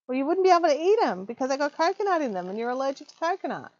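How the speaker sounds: normal talking voice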